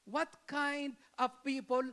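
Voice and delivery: ordinary delivery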